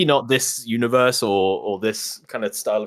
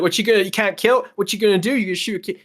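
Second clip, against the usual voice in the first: put-on high, taunting little kid voice; sneering child daring you; bratty kid; 9 semitones higher, faster than usual. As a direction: needle them like a cocky brat. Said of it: "he's being a little dick".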